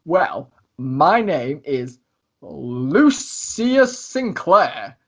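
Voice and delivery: grand, very drawn-out announcement; theatrical boast for the whole room to hear; Lucius, loud self-introduction. Acted. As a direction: draw out every word like a showman. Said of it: "he says this quite loud".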